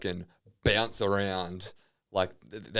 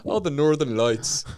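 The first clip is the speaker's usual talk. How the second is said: put-on high, slow Fargo accent; 6 semitones higher, 4 dB louder than usual. Northerner admires the sky, slow Fargo accent. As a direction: gush in stretched Fargo vowels. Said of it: "All I can do is Fargo characters".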